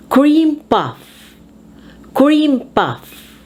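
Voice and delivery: "Cream" high and strong, "puff" dropping; compound stress. Shown as a demonstration. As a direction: hit "Cream", ease off "puff". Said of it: "you emphasize the first word".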